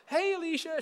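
high, sing-out greeting; I-need-something voice. Retold or imitated. Pastor calling to a coworker, wanting something, in a high wheedling voice. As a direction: call out sweetly high, angling for a favor. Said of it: "That's your I need something voice".

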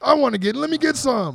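high, loud mock excitement; hammed-up eager brawler; excited wrestler impression. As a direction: crash in thrilled, like a wrestler itching to brawl. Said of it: "music hits and he's like".

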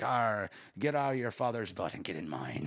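gruff pirate growl, Arr. Retold or imitated. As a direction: play a rowdy old pirate. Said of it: "Those are really good impressions".

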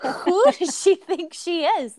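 slow, punched-out retold indignation; indignant. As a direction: act out being offended. Retold outrage at the doctor.

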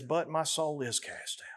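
quiet despair after the outcry; sinking back down. Acted. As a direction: let it fall away hopelessly. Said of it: "he comes out of it again".

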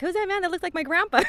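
put-on high, curious little kid voice; child voice.

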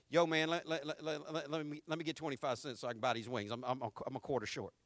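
soft, fast, low stammering plea; pleading beggar. Retold or imitated. Preacher acting a hungry friend begging a quarter. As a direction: mumble the ask for change.

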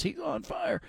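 high hyped arcade announcer call; NBA Jam announcer. Retold or imitated. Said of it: "Reminded me of N B A Jams".

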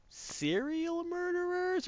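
very high, slow mock question; exaggerated high-pitched querying; drawn-out query. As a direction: stretch it out high and held. Crew evasively queries the question.

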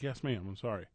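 meek, quiet apology; sheepish. Retold or imitated. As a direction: shrink back and apologize softly. Meek reply to scolding.

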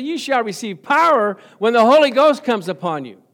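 high, loud preaching of the verse; fervent pulpit declaration; ringing proclamation. Acted. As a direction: proclaim it with full force.

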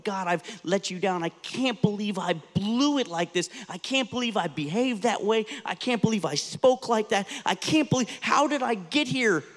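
a voice building in anguish to God; anguished self-reproach. Retold or imitated. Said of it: "those times in our lives when we feel like".

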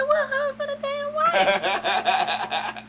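high, slow and laughing; laughing disbelief. Retold or imitated. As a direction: drag it out in laughing shock. Incredulous retort, laughing.

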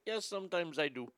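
quiet, put-on Middle Eastern accent. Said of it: "you spoke with a Middle Eastern accent".

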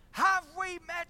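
wife repeating his words in disbelief, outraged; outraged echo. Retold or imitated. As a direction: throw his question back at him, outraged. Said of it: "she said".

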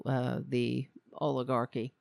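ordinary delivery

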